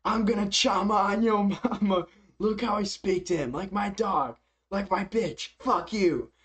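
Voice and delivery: imitating Huckett's trash talk; mocking quote. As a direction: ham up the fighter's taunt. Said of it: "Huckett says".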